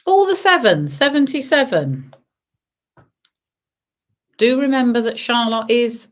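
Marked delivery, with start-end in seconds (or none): 0.0-2.1 sing-song number calling; bingo caller. Acted. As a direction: call the numbers like a bingo host. Bingo calls.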